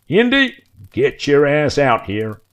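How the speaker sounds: filthy man calling Yindy out of the cabinet; gruff yell. A narrator's reading. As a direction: summon him like a cruel master. Filthy man summons his servant.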